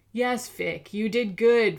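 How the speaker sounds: villain mocks the captive; mocking hiss. A narrator's reading. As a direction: twist "good" into a taunt. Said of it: "Fiddler hissed".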